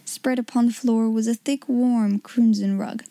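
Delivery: plain reading voice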